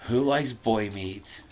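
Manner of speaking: usual speaking voice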